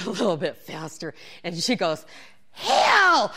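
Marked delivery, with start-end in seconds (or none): 2.5-3.3 high, loud imitated instructor call; instructor's shouted command. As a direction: yell it across the spin class. Instructor calls the hill again.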